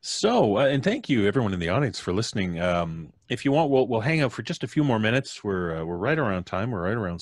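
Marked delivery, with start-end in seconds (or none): none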